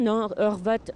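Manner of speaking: ordinary talk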